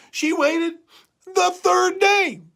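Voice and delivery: high, slow, stretched out; high drawn-out storyteller; drawn out suspense. A narrator's reading. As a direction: build the suspense. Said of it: "building suspense".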